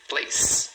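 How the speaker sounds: "place" in a single beat; "place" with no vowel after the S; one syllable. Shown as a demonstration. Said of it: "uma sílaba".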